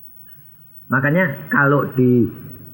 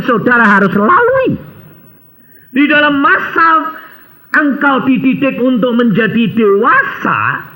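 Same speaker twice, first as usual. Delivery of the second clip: preaching; 12 semitones higher, 4 dB louder than usual. Acted. Preaching with force.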